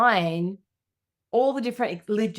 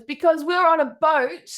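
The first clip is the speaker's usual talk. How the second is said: put-on high, louder child explaining; six-year-old daughter; 9 semitones higher, 6 dB louder than usual. Daughter explaining herself to mum, high child voice. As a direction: imitate an insistent small kid. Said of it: "my little six-year-old daughter".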